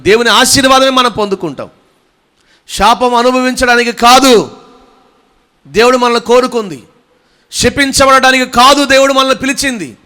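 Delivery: high, loud proclamation; full-force preaching. Real, not acted. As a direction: drive each declaration out to the whole congregation. (గొంతెత్తి ఉద్వేగంగా ప్రసంగం)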